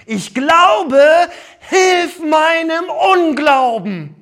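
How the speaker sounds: loud, high and slow, crying out; a despairing father crying out to Jesus; desperate cry. Acted. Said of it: "der schreit Jesus an".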